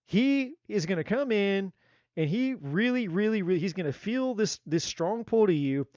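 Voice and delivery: ordinary talk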